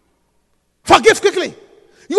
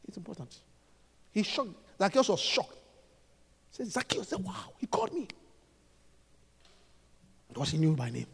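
much softer, almost hushed; very quiet; 8 semitones lower, 19 dB quieter than usual. Real, not acted. Preacher goes very quiet retelling the shock. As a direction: drop right down. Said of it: "sudden very quiet passage".

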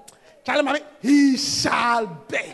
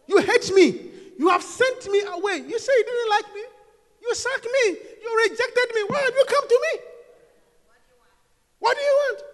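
mild mock confronting; 10 semitones higher than usual. Isaac confronting his enemies.

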